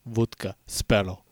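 Sparrow said as spetel with a falling Russian accent. Shown as a demonstration. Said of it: "I have to prepare myself. Vodka".

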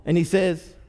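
normal talking voice

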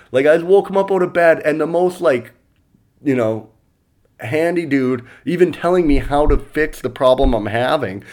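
usual speaking voice

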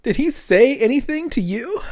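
Cheryl voice. Retold or imitated. Voicing Cheryl, high.

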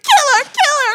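very high, loud mock wail; weak-wristed sixties heroine. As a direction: flail vocally in hammed-up distress. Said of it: "weak-wristed woman thing".

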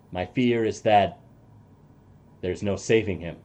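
low, soft and grim; a quiet haunted ally; NPC voice. Acted. Ally fears for Lysander, low and soft.

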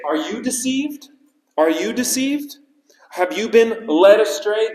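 high mocking put-down; mocking sneer. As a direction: sneer at the officers as gullible fools. Pharisees mocking the officers.

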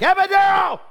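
wife in labor yelling for the epidural, high shout; screaming for an epidural. Retold or imitated. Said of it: "she's like".

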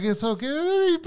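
very high put-on voice of a comforter; mock consoling. Other lady comforting the crying coworker, mock consoling.

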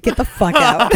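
loud retold outburst; mock anger. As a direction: bark it in laughing outrage. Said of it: "I was so angry".

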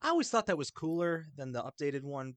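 usual speaking voice

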